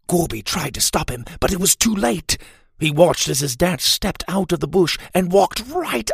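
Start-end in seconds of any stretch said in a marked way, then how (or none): none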